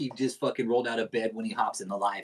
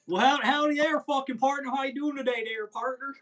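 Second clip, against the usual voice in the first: lightly put-on cowboy voice, higher; playful Western greeting; 8 semitones higher, 6 dB louder than usual. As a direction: ham up a country howdy partner. Mock cowboy greeting bit.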